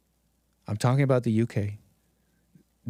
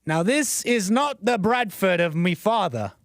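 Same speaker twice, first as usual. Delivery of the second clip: higher, slow, hammed-up British accent; attempted British accent; 6 semitones higher, slower than usual. Vlogger mocking Bradford locals, hammy British accent. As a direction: put on a rough English accent, badly. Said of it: "I don't know what accent that was".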